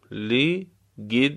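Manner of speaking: very slow لقدام with gaps between syllables. Shown as a demonstration. Teacher breaking down the word for learners, syllable by syllable. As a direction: split لقدام up for dictation.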